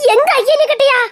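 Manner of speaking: loud, high startled question; alarmed disbelief. Acted. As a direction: blurt it out in alarm. Alarmed he will tell her father.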